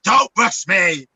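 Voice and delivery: slow, drawn-out diva demand; diva drawl. Retold or imitated. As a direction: stretch it out like a diva. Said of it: "I'm like".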